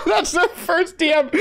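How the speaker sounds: high, slow words through laughter; laughing. Real, not acted. Gamer repeating friend's first DM joke, helplessly laughing. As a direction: squeeze it out while cracking up.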